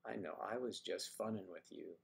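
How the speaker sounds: ordinary delivery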